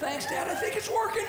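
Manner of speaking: high strained sarcastic reply, laughing; sarcastic thanks. Retold or imitated. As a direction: pretend it helped, through pain.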